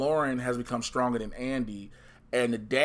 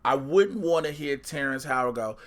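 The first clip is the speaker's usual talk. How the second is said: ordinary delivery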